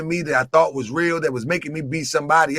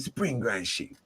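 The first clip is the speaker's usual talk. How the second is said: low, hushed address; 9 semitones lower, 13 dB quieter than usual. Retold or imitated. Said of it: "this how I said it to him".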